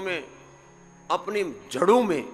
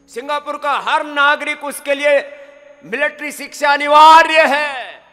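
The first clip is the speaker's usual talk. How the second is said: emphatic declaration; 8 semitones higher, 13 dB louder than usual. Real, not acted. Stressing mandatory military training.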